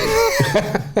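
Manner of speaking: very high-pitched mock-crazed laugh; psycho laugh. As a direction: imitate someone laughing like a psycho. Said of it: "like, psycho laugh".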